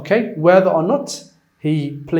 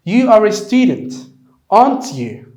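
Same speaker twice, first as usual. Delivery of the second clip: louder, "aren't you?" falling at the end; falling intonation; 5 semitones higher, 6 dB louder, slower than usual. Shown as a demonstration. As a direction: seek agreement, let "aren't you?" drop.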